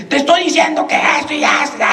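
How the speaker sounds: high-pitched mock scolding; imitated angry shout. Boss berating his employee before a visiting pastor, mock shout. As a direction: play the boss yelling orders. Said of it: "Y le empieza a gritar a él".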